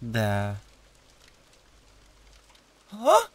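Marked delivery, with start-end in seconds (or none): 2.9-3.3 high, startled, stammering; flustered surprise. Acted. A second demon flustered at spotting another demon. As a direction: caught off guard and fumbling for words.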